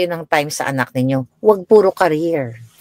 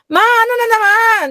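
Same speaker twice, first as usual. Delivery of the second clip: put-on much higher, exasperated teen whine; whiny daughter. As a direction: play the annoyed daughter. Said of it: "sabi ng anak ko". (iritadong anak na mataas ang boses)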